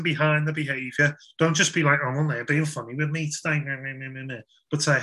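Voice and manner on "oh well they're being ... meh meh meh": petty moan fading into soft meh-meh mumbling; mock grumbling. Preacher mimicking someone moaning about a mate, mock grumbling. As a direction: grumble mockingly, trailing into quiet nonsense. Mocks a grumbling friend, then nonsense.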